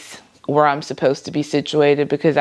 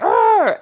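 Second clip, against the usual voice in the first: high, loud frustrated "arr"; frustrated growl; 3 dB louder than usual. Retold or imitated. To her family about her day, a high mock growl. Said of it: "And I was like".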